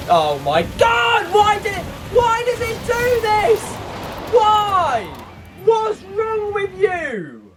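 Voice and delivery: high, slow, drawn-out cries at the screen; real rage building to a high drawn wail; exasperated shouting. Real, not acted. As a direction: wail at the broken monitor.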